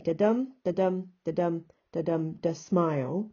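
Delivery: chanted "da dum" beats landing on "smile"; weak "da", punched "dum", "smile" as last stress; iambic rhythm. Shown as a demonstration. Teacher sketching a poem line, iambic rhythm. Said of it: "write it out almost like this and say".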